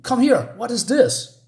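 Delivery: mock-indignant shout across the house; retold shout. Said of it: "then he shouts to Taylor".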